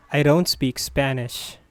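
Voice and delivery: usual speaking voice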